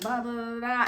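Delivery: ordinary talk, nothing marked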